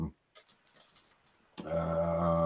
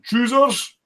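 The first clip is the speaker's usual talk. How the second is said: squeaky high mock voice on one word; mock chat voice; 13 semitones higher, 5 dB louder than usual. Reading a viewer's chat line in a squeaky high voice. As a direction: pitch it way up like a silly squeak. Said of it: "Sprue Gladic was going".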